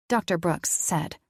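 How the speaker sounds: ordinary delivery, nothing marked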